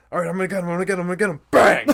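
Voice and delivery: loud, high excited build to a bang; mock hunting excitement. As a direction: act out a trigger-happy hunter, exploding on the last word. Acting out a hunting accident.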